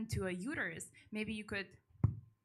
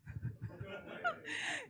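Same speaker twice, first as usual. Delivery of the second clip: high airy laugh with no voice; breathy high laugh; 6 dB quieter than usual. Real, not acted. Host giggling about the comics' English, airy high laugh.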